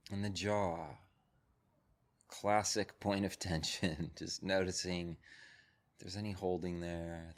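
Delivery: calm, measured voice with long pauses; guided meditation. Real, not acted. Teacher settles a group into breath and body, guided meditation.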